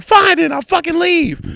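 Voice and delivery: loud falsetto huff; squawked mock outrage; mimicked storming-out friend. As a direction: shout it high, mocking her exit.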